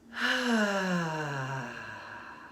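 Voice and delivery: long, voiced "ahh" on the out-breath; cleansing sigh. Shown as a demonstration. Leading listeners in cleansing breaths, long audible sigh. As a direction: exhale audibly, letting it fall away. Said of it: "like I'm doing, feel free to make noise".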